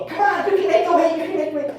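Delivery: high, fast rallying cheer; team cheering. Retold or imitated. Teammates rallying the boy to make weight, high cheering. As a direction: pump him up like a team. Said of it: "all the team is rallying".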